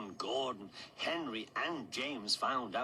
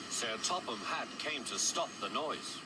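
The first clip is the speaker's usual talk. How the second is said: plain reading voice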